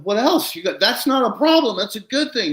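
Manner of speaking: high-pitched happy disbelief; retold excited protest. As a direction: act out thrilled correcting of a worrier. Retold excited reply to supposed problem.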